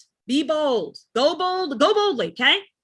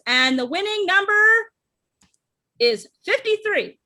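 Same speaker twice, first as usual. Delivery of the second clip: raffle caller; 7 semitones higher, 4 dB louder than usual. Acted. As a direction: build suspense, then reveal the number. Announcing the raffle winner.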